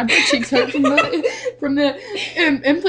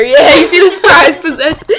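louder showy presenter; 3 semitones higher, 8 dB louder than usual. Pretend. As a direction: show it off loudly.

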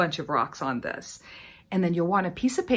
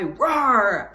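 lion roar; 5 dB louder than usual. Shown as a demonstration. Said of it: "lion with the roar".

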